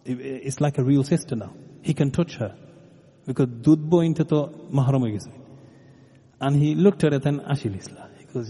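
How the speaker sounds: ordinary talk, nothing marked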